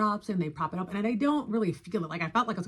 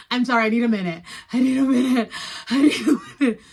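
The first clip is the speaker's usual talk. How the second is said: panicked plea; 5 semitones higher, 9 dB louder, faster than usual. Retold or imitated. Panicking before surgery, freaking out.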